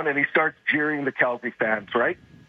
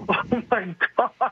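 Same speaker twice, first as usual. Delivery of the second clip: higher, laughing disbelief; exasperated laughing; 7 semitones higher, slower than usual. Real, not acted. Caller scoffing at the commissioner's plan, exasperated laughing.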